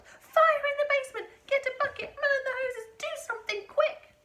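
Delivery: high, frantic shouted orders; yelling. A narrator's reading. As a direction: shriek orders as if the house is burning. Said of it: "the old woman yelled".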